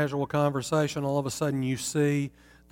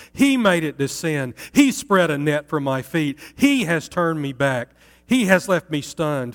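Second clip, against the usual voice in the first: loud, raised, anguished accusation against God; 3 semitones higher, 5 dB louder than usual. Acted. The city accuses God who crushed her, loud anguished protest. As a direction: hurl the grief at heaven. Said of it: "Listen to her tone".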